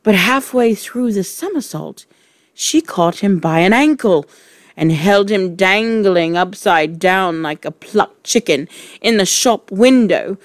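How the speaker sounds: usual speaking voice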